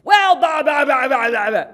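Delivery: high, shouted nonsense tirade; husband's angry rant. Retold or imitated. Husband picking a fight with his wife, imitated shouting. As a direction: bellow mock-furious babble, tailing downward. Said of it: "he would kinda do that".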